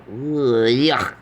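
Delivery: loud mock disgust; hammed-up yuck. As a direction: groan it out in disgust. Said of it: "I go".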